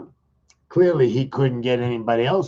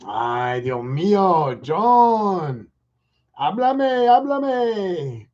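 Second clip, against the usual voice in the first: put-on high, dramatic Mexican accent; 7 semitones higher, 3 dB louder, much slower than usual. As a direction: ham up a sexy Mexican accent. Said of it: "start you off with a little Sexican".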